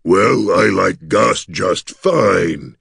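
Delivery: very slow, astonished gargoyle; gasped surprise. A narrator's reading. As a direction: accept haltingly in a creature voice, taken aback. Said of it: "he gasped".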